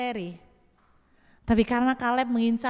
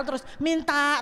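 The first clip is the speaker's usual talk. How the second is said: ordinary talk, nothing marked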